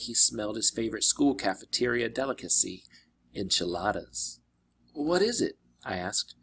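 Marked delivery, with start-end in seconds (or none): 4.9-5.6 higher, worried question; boy's voice, raised. Acted. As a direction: ask it with a boy's sudden unease. Percy asks worried.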